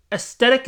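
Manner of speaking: high questioning "aesthetic" pronounced "es-TET-ick"; unsure pronunciation. Real, not acted. Vlogger checking how you say it, "aesthetic" as a high "es-TET-ick?". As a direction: try "aesthetic" with S-T for TH, pitched right up. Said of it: "Is that how you say it?".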